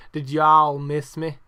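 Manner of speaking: loud, slow Southern drawl; imitated Southern wrestler's call; put-on Southern accent. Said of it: "so he says".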